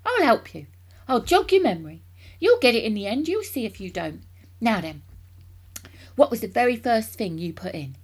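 loud, eager encouragement; excited cry. A narrator's reading. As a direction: coax him on heartily. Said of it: "cried Mister Cranky".

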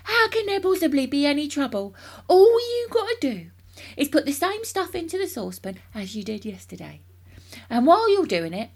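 loud, high, insistent; father running away with his plan; excited cry. A narrator's reading. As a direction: overrule him with booming enthusiasm. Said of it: "cried Mister Cranky".

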